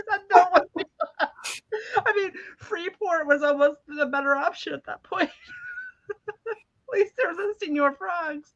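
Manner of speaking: high, squeaky talking through laughter; real laughing so hard it sounds tearful; helpless laughter. Real, not acted. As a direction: squeeze the words out between laughs. Laughing over own misfortune.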